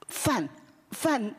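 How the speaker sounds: usual speaking voice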